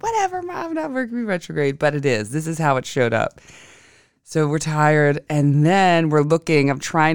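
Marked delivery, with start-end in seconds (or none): 0.0-1.8 put-on high, whiny dismissive kids. Tired kids brushing off mom's Mercury retrograde, whiny. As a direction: roll your eyes like tired kids. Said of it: "They're like".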